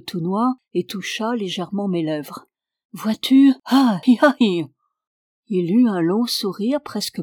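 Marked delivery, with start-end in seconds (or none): 2.9-4.6 loud, high exclamations; peasant's clucking cries; fussing peasant. A narrator's reading. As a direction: exclaim in concern over a trembling child. Peasant fusses over trembling child.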